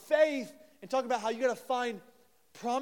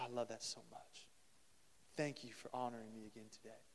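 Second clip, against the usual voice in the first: much softer, lower, hushed and fond; tender voice of God; 9 semitones lower, 16 dB quieter than usual. Acted. God in heaven delights in Sunday worship, soft and tender. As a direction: speak in awe, gently delighted.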